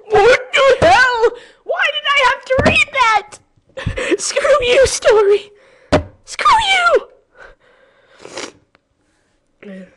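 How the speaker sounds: very high, loud disbelieving scream; outraged screaming. Real, not acted. Reacting to the story's twist.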